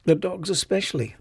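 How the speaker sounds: usual speaking voice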